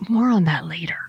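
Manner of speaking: breathy teaser whisper; whispered tease. Real, not acted. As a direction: hush it like a secret. Teasing aside, whispered.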